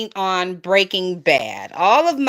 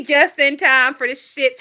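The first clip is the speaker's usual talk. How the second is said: usual speaking voice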